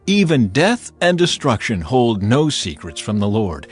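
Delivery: ordinary talk, nothing marked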